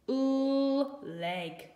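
"Oo" sung high and long, then "leg"; oo sound. Shown as a demonstration. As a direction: stretch "Oo" up high before "leg". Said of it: "writing oo".

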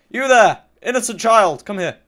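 loud, high hail across a distance; mock-grand calling out. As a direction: holler theatrically at a victim. Calling out to another player.

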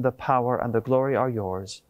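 ordinary talk, nothing marked

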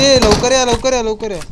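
loud, high call to teammates; urgent shouting. Real, not acted. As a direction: shout them over in a hurry. Urging teammates to come quickly in game.

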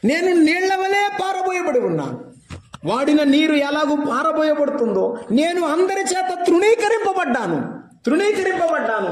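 high, ringing preacher's cry; preaching at full force. Acted. A preacher lifting his voice to the congregation. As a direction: proclaim it to the whole hall.

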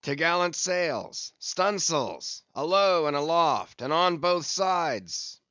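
high, slow, carrying commands; shouted sail orders. A narrator's reading. Captain ordering all sail set, calling out loudly. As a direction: draw out each order so it carries aloft.

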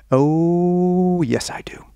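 mock-coy, savoured reaction; drawn-out coo. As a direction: stretch it out like a knowing fan. Acting the woman's delighted answer.

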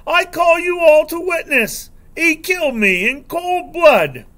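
high, loud wailing accusation; hammed-up outraged wail; screeching yell. A narrator's reading. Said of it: "he yells".

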